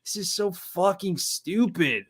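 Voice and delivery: slow disgusted mutter; exasperated disbelief. Retold or imitated. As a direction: drag it out like you can't believe it. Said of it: "And I'm just like".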